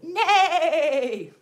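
loud, laughing whinny neigh; whinny it like a horse; playful horse neigh; horse noise. Shown as a demonstration. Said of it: "And it says".

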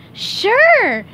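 bursting, high and loud Sure; eager yes, shot up high; excited. Acted. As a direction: jump at the offer with glee. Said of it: "he said, excited".

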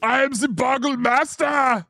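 booming Austrian action hero boast; big echoing villain brag; Arnold impression. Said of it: "Clip this Arnold impression".

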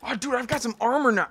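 ordinary talk

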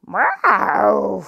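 loud mock roar; lion roar meow. Lion bellowing at feeding time, loud mock roar. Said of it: "räyhäsi leijona".

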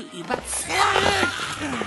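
loud, strained protest; strained shout. Acted. Doctor protests loudly while being held. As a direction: cry out while being grabbed.

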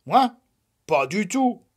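high, loud indignant grumble; sulky indignant grumble; grumbling. Acted. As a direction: deny it like a grumpy child. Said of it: "grogna Lapin".

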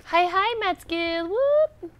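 high, loud playful greeting; playful excited. Real, not acted. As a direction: sing out a cheery hello.

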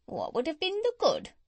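high, swooping parrot voice; Polynesia. A narrator's reading. Parrot answering the doctor, high swooping bird voice. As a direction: toss it back like a bird. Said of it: "said Polynesia".

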